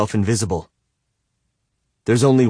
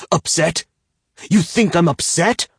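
incredulous anger; 9 semitones higher than usual. A narrator's reading. Bound man to captor, angry disbelief. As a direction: throw her words back at her.

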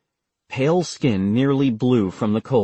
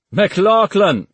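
much higher, louder call of the name; good-natured shout; 9 semitones higher, 6 dB louder than usual. Acted. Officer calls McLaughlin out with a loud good-natured shout. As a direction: bark his name cheerfully. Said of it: "the X O shouted, sounding good-natured".

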